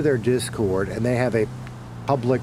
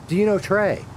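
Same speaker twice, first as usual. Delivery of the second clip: much higher, silly newbie voice; mocking newbie voice; 10 semitones higher, 3 dB louder than usual. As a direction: ask like an eager clueless kid. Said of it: "ask questions that have already been answered".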